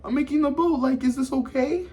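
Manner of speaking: lightly voiced gentle girl asking permission; innocent girl. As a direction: chirp it up high like a naive girl. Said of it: "And she's like".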